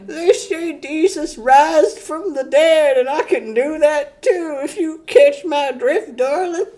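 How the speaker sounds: put-on high leering old-man voice with cackles; a dirty old grandpa bragging, laughing through it; creepy Peepaw. Peepaw bragging to a girl, high, leering and cackling. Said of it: "Peepaw's got".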